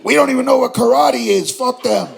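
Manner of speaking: loud, high mock rant; loud mock angry outburst; mock outrage. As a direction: rant it big and indignant. Mock angry rant about them.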